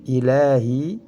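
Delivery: "Ilahi" with the la stretched; recited "Ilahi", lengthened middle syllable; two-beat long vowel. Shown as a demonstration. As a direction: hold the a in "Ilahi" for two beats. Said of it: "panjang dua harokat".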